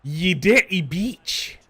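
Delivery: loud, slow, high Scottish insult; mock-outraged Scottish yell; Scottish accent. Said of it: "Bald and Scottish".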